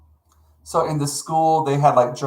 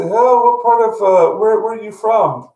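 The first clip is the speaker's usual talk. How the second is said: high, bright eager asking, louder; imitating eager locals asking where he's from; excited retold question; 8 semitones higher, 5 dB louder than usual. Locals in Scotland quizzing the visitor, excited and loud. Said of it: "people would come up to me and say".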